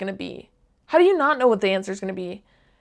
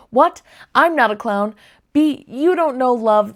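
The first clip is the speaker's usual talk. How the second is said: ordinary talk, nothing marked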